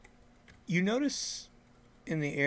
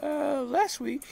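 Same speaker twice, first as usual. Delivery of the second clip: odd farmer voice; 13 semitones higher, 4 dB louder, slower than usual. Acted.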